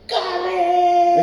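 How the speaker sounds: high, drawn-out outraged shriek; top-of-lungs scream. Retold or imitated. Schoolboy discovering his sandwich filling, screaming at the top of his lungs. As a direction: imitate a boy screaming his head off. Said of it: "he'd scream at the top of his lungs".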